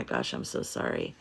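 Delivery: near-whispered, real sorrow; hushed sympathy. Real, not acted. She murmurs sorry to a grieving viewer, hushed. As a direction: breathe the apology softly. Sympathy after parents' death comment.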